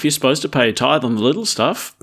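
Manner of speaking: normal talking voice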